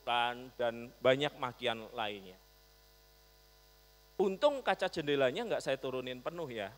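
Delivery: usual speaking voice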